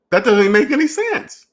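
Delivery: high disbelieving "doesn't make any sense"; incredulous. Real, not acted. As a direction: sound baffled by the idea. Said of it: "see how that sounds?".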